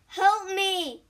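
high, loud call for help; cry for help. A narrator's reading. Injured man calling to travelers on the mountain, crying out. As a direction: call out to be rescued. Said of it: "cried a man".

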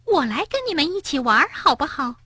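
high piping stranger voice; shrill voice. Acted. New hare butting in on the game, shrill. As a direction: pipe up shrilly from behind. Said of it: "一个尖声尖气的声音".

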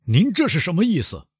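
high, pressing question; loud demand. A narrator's reading. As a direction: push hard for an answer. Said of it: "庭长大声地说".

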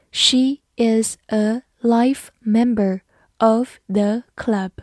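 slow, deliberate reading aloud. Shown as a demonstration. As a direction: read "She is a life member of the club" slowly. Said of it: "好，慢慢来读。". (慢慢读)

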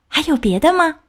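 high, urgent question; eager, impatient. A narrator's reading. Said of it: "鱼着急地问道".

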